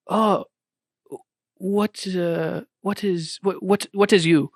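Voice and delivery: halting, confused imitated Korean accent. Shopkeeper puzzled by the customer, mock Korean accent. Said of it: "he's just an old Korean guy".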